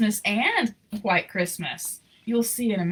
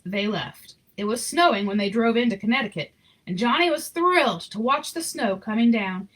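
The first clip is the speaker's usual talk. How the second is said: gentle read-aloud storyteller voice; story narration; 4 semitones higher than usual. A narrator's reading. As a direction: read it like a bedtime picture book. Reading picture book aloud.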